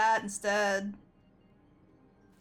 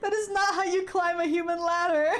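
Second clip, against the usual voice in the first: laughing protest; 5 semitones higher than usual. Real, not acted. Laughing disbelief at game scene.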